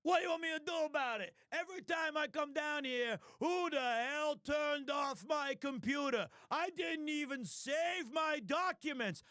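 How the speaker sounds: distant, muffled, high detective grumble; Gumshoe voice. Shown as a demonstration. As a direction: gripe like a harried cop from afar. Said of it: "I go like".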